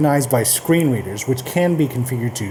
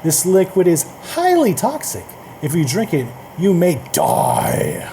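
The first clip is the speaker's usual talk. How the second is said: hammed-up warning, stretching "die"; strong emphasis; 3 semitones higher than usual. Shown as a demonstration. Reading a nested-emphasis warning example, dramatic stress on "highly" and "die". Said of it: "strong and emphasis inside one another".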